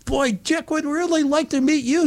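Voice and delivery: high-pitched, eager phone voice; gushing welcome. Retold or imitated. Celebrity's wife eagerly greeting a caller, gushing. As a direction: gush like a delighted host.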